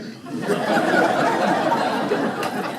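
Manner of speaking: airy unvoiced laughter; breathy laugh. Real, not acted. Laughs after punchline.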